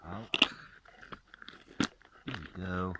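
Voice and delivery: usual speaking voice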